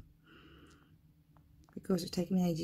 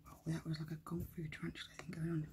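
quick, much softer unvoiced whisper; fast whisper; 2 semitones lower, 11 dB quieter, faster than usual. Real, not acted. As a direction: rush it out in a hush. Whispered excited aside.

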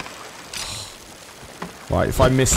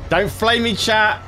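higher, whiny plea to the viewers; sheepish high plea to the stream; pleading with chat; 7 semitones higher than usual. Real, not acted. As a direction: beg chat not to mock you. Pleading with chat after a blunder.

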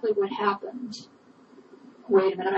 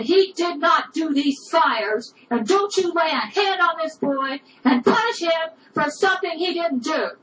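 angry insistence; 6 semitones higher than usual. Retold or imitated. Wife defending boy to husband.

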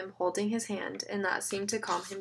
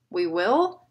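growled; 6 dB louder than usual. A narrator's reading. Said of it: "Gary growled".

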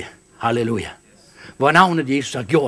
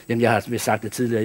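ordinary talk

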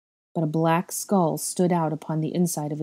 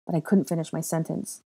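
ordinary delivery, nothing marked

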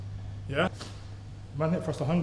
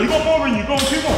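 loud, high push to finish the set; coaching shouts; 7 semitones higher, 8 dB louder than usual. Real, not acted. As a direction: drive the lifter through the last reps. Coach pushing lifter.

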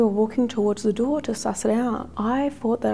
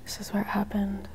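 hushed whisper at the scene; 6 dB quieter than usual. Real, not acted. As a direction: breathe it out in a whisper. Whispering at the haunted spot.

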